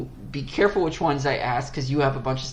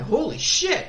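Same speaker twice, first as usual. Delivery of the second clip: shocked; 13 semitones higher, slower than usual. Retold or imitated. Retold shock at movie.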